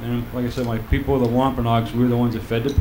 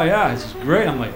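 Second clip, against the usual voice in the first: ordinary talk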